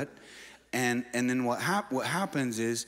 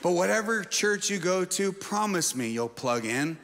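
raised, driving exhortation, slowing on key phrases; fervent preaching; 7 semitones higher, 4 dB louder than usual. Acted. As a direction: push them to commit, building intensity.

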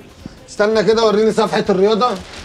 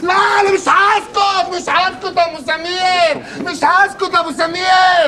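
high shouted tirade; angry ranting; 7 semitones higher than usual. Acted. Ranting about his brother.

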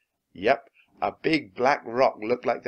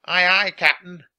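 pirate crew reply; 9 semitones higher, 10 dB louder than usual. A narrator's reading.

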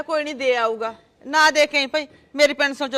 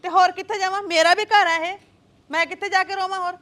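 indignant quarrel; 4 semitones higher than usual. Acted. Quarrel over crying at home.

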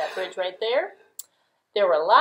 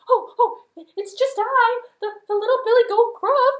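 very high, loud panicky squeak; frightened goat; 9 dB louder than usual. Acted. Little goat facing the troll, very high frightened squeak. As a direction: squeal in terror like a tiny goat.

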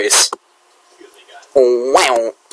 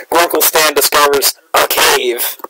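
ordinary talk

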